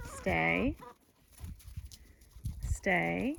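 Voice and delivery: low, calm command to stay; firm dog command. Real, not acted. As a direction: hold the dog steady, calmly. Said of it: "commanding the dogs to stay".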